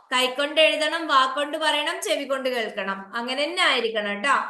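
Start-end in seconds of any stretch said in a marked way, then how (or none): none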